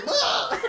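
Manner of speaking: squeaky high yelp; short high cartoon cry; high cartoon shriek. Acted villain cry.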